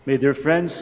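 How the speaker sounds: ordinary talk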